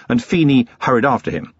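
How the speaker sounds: ordinary delivery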